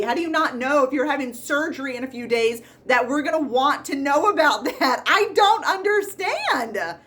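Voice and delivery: loud, high-pitched mock tirade at a patient; exasperated rant. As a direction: let exasperation boil over, comically overblown.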